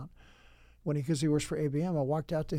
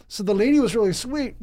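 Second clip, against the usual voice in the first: normal talking voice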